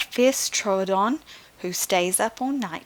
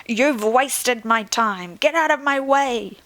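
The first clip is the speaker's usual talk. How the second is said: angry outburst; 4 semitones higher, 4 dB louder than usual. Acted. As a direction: throw them out in a fury. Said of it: "cried out in dismay".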